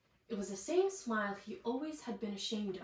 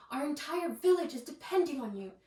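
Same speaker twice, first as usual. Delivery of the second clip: pleading; 5 semitones higher, 5 dB louder than usual. A narrator's reading. Mother pleading.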